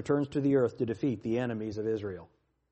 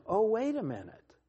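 high, softer feigned surprise; mock realisation; 7 semitones higher, 4 dB quieter than usual. Preacher stops his reading at a familiar picture, mock surprise. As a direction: act as if it just dawned on you.

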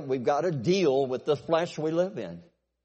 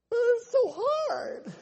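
high, very slow whine; whiny reply; much slower than usual. Acted. Son whining back at the demanding Bible, high and slow. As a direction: drag it out like a sulking kid.

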